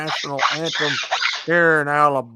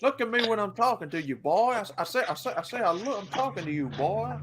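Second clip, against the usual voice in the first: mock scolding Southern dad; 6 semitones higher, 5 dB quieter, faster than usual. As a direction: scold the boy like a cartoon Southern father. Scolding father impression.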